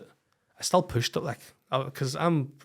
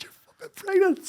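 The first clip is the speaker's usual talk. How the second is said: much higher and louder, overjoyed disbelief; amazed shout; 5 dB louder than usual. Retold or imitated. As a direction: shout the news in sheer amazement.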